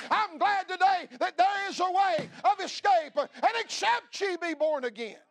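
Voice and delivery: loud, high-pitched revival preaching; fiery Baptist preacher pouring it out; preaching at full force. Acted. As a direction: thunder it from the pulpit. Preaching hell to the congregation.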